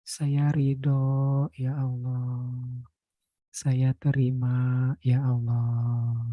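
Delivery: soft, measured surrender prayer with slow ya Allah; prayerful affirmation, trailing softly; guided prayer. Acted. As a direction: guide them gently into letting go.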